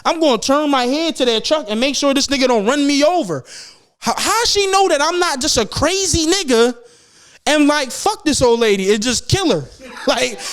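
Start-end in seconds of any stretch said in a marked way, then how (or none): none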